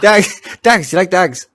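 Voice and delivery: high, mock Irish brogue; hammed-up Irish film quote; film-quote Irish accent. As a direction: mimic a line from Snatch in Irish. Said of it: "are you trying to quote Snatch".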